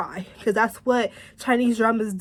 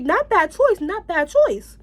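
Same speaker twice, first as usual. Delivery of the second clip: impressed approval; 8 semitones higher, 4 dB louder than usual. Retold or imitated. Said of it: "I'm like".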